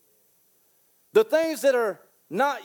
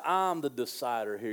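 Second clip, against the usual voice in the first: lightly voiced arrogant king; 10 semitones lower, 7 dB quieter than usual. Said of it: "He said".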